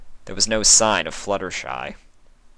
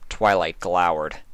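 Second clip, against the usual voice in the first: usual speaking voice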